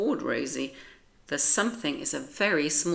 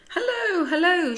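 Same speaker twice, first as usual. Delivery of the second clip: very high, loud excited yelps; shouting; 14 semitones higher, 7 dB louder than usual. Acted. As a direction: shout hello up to the sky. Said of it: "she shouts".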